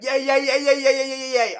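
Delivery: high, loud squealing yays over and over; mock excited yay. Mocking a friend's seven texted yays, squealing. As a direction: gush each yay like an overexcited texter. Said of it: "They're like".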